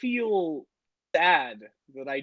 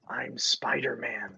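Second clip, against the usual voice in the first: slow, hushed whisper; whispering a secret identity to his sister; 5 semitones lower, 6 dB quieter, slower than usual. Pretend. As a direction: confide it like a secret.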